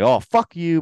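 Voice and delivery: half-acted angry outburst; mock screaming match. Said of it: "They start screaming at each other".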